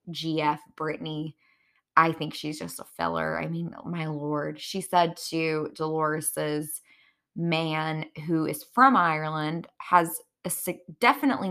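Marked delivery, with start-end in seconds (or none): none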